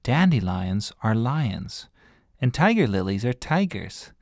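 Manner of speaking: slow, patient explanation of the joke; slow explaining. Acted. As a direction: spell it out slowly so they get it. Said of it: "she imagined having to explain it".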